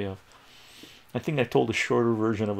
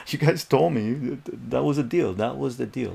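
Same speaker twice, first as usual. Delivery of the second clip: louder, higher insistence easing off; mock indignant protest; 3 semitones higher, 5 dB louder than usual. Kid arguing with parents over the drum deal, mock indignation. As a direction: re-enact a kid holding parents to it.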